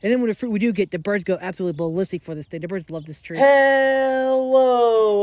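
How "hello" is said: loud, high vlog greeting; excited hello. Real, not acted. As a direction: greet the viewers with a big hello. Vlog opening greeting to viewers.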